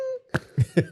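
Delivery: a very high squealing burst of laughter. Real, not acted. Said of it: "They don't sp".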